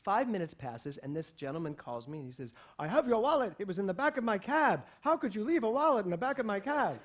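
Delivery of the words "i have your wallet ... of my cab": scolding. Retold or imitated. Driver scolding on the phone.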